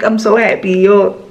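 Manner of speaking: ordinary talk, nothing marked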